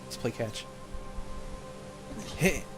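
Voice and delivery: ordinary talk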